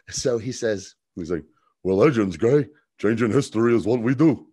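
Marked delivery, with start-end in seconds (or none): 1.8-4.5 louder comedian impression; Jackie Mason. Jax talking to Stein about changing history, comedian impression. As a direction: put on a comic's voice, punched out loud. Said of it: "it's gonna be as Jackie Mason".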